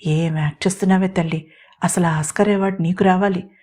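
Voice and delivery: usual speaking voice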